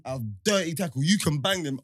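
ordinary talk, nothing marked

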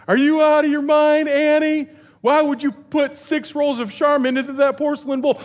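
mock-scolding dad; exasperated parent. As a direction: scold the kid in disbelief. Parent scolding kids, retold.

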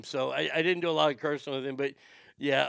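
normal talking voice

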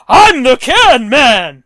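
loud, slow, chest-puffed announcement; mock superhero proclamation. Junker proudly naming himself to storyteller, mock heroic shout. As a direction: proclaim it like a hammed-up hero. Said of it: "He puffs out his chest".

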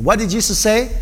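usual speaking voice

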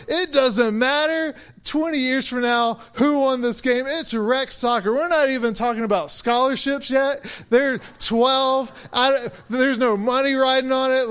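worked-up, incredulous reasoning; exasperated protest. Retold or imitated. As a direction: argue it like it's obvious. Exasperated retort to soccer parents.

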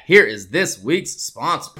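normal talking voice

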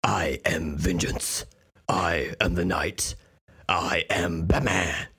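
slow, low, husky superhero declaration; Batman. Acted. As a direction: declare it gravely, drawing out every word. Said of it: "playing the role of Batman".

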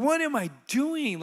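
high, drawn-out retold disbelief; stunned realization. Man realizing he is loved, emphatically stunned. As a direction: stretch WHAT high, asking yourself in amazement. Repeats the realization more strongly.

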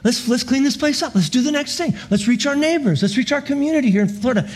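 rally everyone cheerfully to get to work; joyful church. Pretend. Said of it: "with just great joy".

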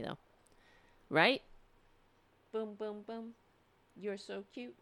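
tender murmured pet talk; soft cooing to pet. Real, not acted. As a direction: coo at the cat softly. Cooing softly at the cat.

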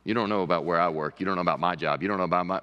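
a low, grumbling, put-upon voice; mock defensive complaint. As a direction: play the listener getting defensive about their job. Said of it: "you say".

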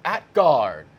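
loud announcer voice with awkward hesitation; stall, then stumble out the player introduction; mock PA call with too long a pause; botched lineup announcement. Shown as a demonstration. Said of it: "if you pause too long before".

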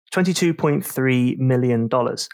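usual speaking voice

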